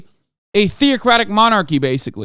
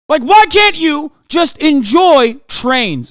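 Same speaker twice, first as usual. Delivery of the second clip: slow, higher, loud pleading shout; exasperated shout; 9 semitones higher, 7 dB louder, slower than usual. Real, not acted. Begging a chatter to just like trains, slow shout. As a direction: drag it out in disbelief.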